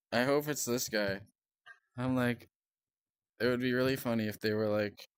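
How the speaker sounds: ordinary talk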